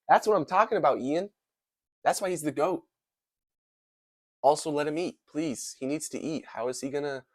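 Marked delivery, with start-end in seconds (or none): 0.1-5.8 loud, pumped-up hype; excited cheering. Real, not acted. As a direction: cheer him on like a fan. Cheering Ian on while watching.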